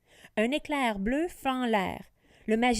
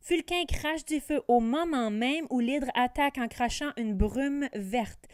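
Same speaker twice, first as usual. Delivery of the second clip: ordinary delivery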